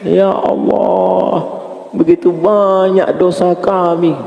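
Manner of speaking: high, drawn-out plea to God; acted tearful-retreat prayer, very slow; imitated muhasabah prayer. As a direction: stretch it into a theatrical supplication. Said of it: "Bagian muhasabah".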